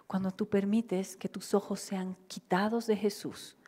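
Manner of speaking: ordinary talk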